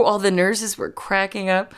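ordinary delivery

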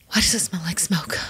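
whispered worried question. Real, not acted. Guest noticing smoke on the ship, whispered. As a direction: hush it, sniffing the air.

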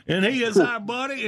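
ordinary talk, nothing marked

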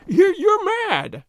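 high, loud, drawn-out outburst; agitated cry. A narrator's reading. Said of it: "he cried".

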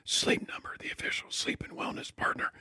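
whispered ad read; whispering. Pretend. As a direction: read the ad in a hush. Whispering the ad, keep quiet joke.

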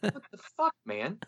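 high-pitched, soft mock protest; retold squeak of feeling attacked; mock indignation. Retelling being laughed at by everyone.

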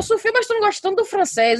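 high, disbelieving question to the daughter; incredulous mom. Retold or imitated. Mother asks daughter why she refuses, incredulous and high. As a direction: sound amazed she'd refuse. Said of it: "E eu".

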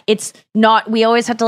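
normal talking voice

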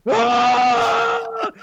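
high, loud imitation scream; drawn-out high "aah", mimicking a screaming kid; imitated scream. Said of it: "He starts screaming".

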